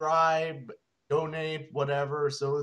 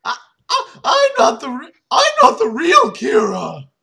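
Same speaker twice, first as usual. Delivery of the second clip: very high, loud panicked shout; scared shout; 11 semitones higher, 12 dB louder than usual. Acted. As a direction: stammer and yell in terror. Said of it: "shouted Mikami, who was scared".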